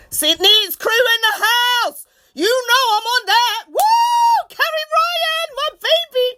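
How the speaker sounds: high, loud calling; excited shout-out. Real, not acted. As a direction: cheer on friends. Shout-outs to friends.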